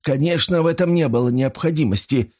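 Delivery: nervous. A narrator's reading. Pawnbroker agrees with Paul after a scare, nervous. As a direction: agree with a touch of nervous tittering. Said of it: "нервно хихикнул он".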